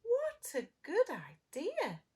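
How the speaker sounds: put-on high, slow delighted girl; little girl voice. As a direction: gush it like an eager child.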